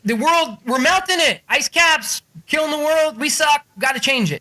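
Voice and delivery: high-pitched mock alarm; Al Gore. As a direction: plead urgently like a doomsday campaigner. Acting out Gore's alarmed plea.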